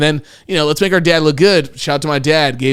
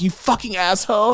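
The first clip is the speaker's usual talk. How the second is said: high, softer mock cursing; lightly hammed-up indignation; retold insult, mock outrage; 8 semitones higher, 5 dB quieter than usual. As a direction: imitate an appalled date, pitched up. Retold date cursing him.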